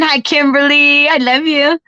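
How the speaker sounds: real, affectionate high-pitched greeting. Real, not acted. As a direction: call it out sweetly to a friend. Greets a friend joining online.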